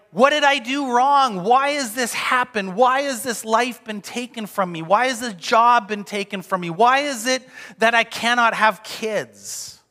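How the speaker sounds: high, loud retold plea; insistent why-questions, piled up in mock anguish; anguished questioning. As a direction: imitate people pleading for a reason. Voices distressed church members' questions.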